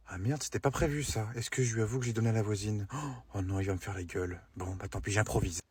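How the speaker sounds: muttered softly to himself in a panic; hushed aside. Acted. Husband panicking quietly to himself about the lost gift. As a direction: keep it under your breath so she won't hear.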